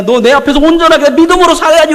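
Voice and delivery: loud booming rebuke with 믿음으로 punched hard; God scolding loudly. Acted. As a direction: scold Abraham at full volume. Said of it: "막 혼내요". (큰 소리로 꾸짖듯, 믿음으로를 힘주어)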